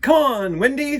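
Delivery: high, loud and eager; eager invitation. Acted. Peter calls Wendy to see mermaids, eager. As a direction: call her along brightly. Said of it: "said Peter".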